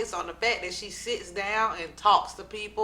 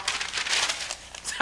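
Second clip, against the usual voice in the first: breathy laugh; 4 dB quieter than usual. Real, not acted. Laughing at own note-taking.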